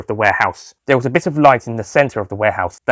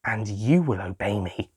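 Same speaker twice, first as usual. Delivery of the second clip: soft, slow, menacing; calmer, quiet; 8 dB quieter, slower than usual. Acted. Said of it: "He calmed down a bit".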